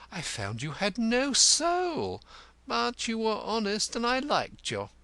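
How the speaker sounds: high, mournfully grand declamation; wounded, pompous Cantercot. Acted. Cantercot renouncing the cobbler's friendship, high theatrical grief. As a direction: orate the end of a friendship in a lofty, high voice.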